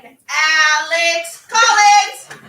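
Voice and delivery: long, sing-song call of a name, loud and high; calling his name. Retold or imitated. Teacher recalling how she greets her student each day, sung-out call. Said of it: "and I miss saying".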